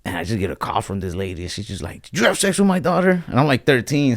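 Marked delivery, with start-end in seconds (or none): 2.0-3.2 high-pitched imitation of an accusing mother; mock accusation. Girl's mom confronting a boy by phone, mock accusation. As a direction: pitch it up as a retold demand.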